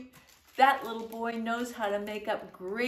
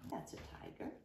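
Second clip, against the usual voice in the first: whisper; 16 dB quieter, faster than usual. Acted. Whispered aside to listener.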